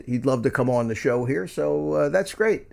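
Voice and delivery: usual speaking voice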